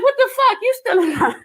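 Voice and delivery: incredulous laughing what the fuck; laughing disbelief. Real, not acted. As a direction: laugh it out, can't believe it.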